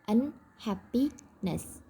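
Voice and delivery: unhappiness very slow, with gaps between un, happy, ness; teacherly slow un... happi... ness; word broken into parts. Shown as a demonstration. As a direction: split unhappiness into three separate slow parts. Teacher breaks word into morphemes.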